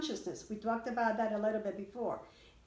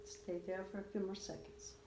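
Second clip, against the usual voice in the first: guided breathwork; 4 semitones higher than usual. Acted. Teacher guiding the class through breath holds, calm and rhythmic.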